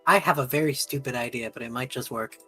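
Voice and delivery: ordinary talk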